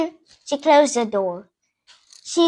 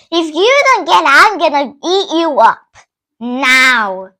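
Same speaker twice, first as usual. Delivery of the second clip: fox threatening; 6 dB louder than usual. Retold or imitated. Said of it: "Mister Fox was like".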